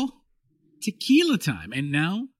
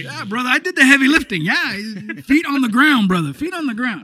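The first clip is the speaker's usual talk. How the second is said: louder, boisterous mock father; hammed-up proud dad; 5 semitones higher, 5 dB louder than usual. Son imitating his dad boasting about the crossing, hammed-up. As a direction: play the proud papa bragging. Voicing dad's Cuban bravado.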